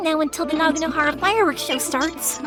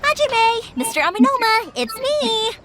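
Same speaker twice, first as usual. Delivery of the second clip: bright excited call, higher than usual; cheery greeting; 5 semitones higher than usual. Acted. Yoimiya greets the smiths on arrival, cheerfully calling out. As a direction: sing out a happy hello.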